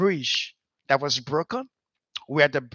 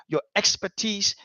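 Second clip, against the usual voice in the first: normal talking voice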